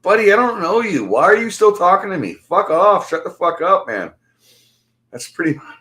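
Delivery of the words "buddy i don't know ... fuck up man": loud, high-pitched exasperated telling-off; angry brush-off. Retold or imitated. Replaying how he told the stranger to shut up, angry. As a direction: tell him off, raised voice, pitched up.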